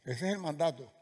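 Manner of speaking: usual speaking voice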